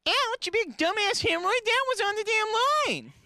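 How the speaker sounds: very high, squeaky furious protest; angry Pillsbury Doughboy. Acted. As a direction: shriek the insult like an outraged dough toy. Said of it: "Doughboy argument".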